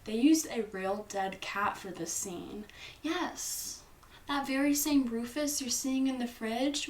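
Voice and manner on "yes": ordinary delivery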